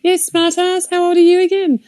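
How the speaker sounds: sarcastic retort. Retold or imitated. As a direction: needle the kid playfully. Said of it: "I'm like".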